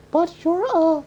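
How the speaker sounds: put-on high, stammering girl; high young woman voice. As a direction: stutter it high and unsure. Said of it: "Mushu hisses".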